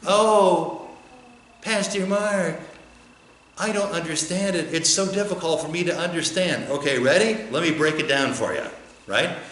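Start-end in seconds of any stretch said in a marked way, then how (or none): 0.1-6.7 higher, whiny, put-upon voice of a baffled listener; confused churchgoer. Pretend. Pastor mimics a baffled congregant complaining to him, higher mock whine. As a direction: play the lost congregant, lightly mocking.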